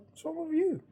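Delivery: high-pitched imitation American accent; [name]. Mate chatting back to the dreamer, American accent. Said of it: "chatting back to me in his American accent".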